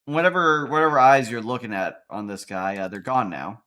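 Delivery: ordinary delivery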